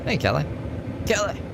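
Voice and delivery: high calling shout. Real, not acted. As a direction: call her name across the room. Calling out to Kelly.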